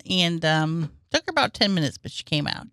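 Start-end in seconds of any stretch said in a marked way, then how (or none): none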